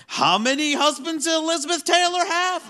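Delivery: high-pitched, hammed-up fake excitement; forced enthusiasm. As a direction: perform it as if you're still into it. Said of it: "performing ability to make it feel like you're into it".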